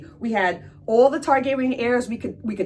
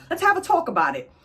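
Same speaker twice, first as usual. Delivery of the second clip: put-on higher and faster, a stalling old king; hammed-up royal brush-off, higher; mock king, higher; 7 semitones higher, faster than usual. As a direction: toss it off quickly, pitched up. Old king repeats his stalling line.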